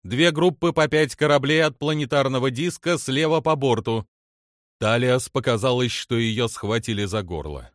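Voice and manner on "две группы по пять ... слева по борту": crew report. A narrator's reading. Crew member reports incoming ships.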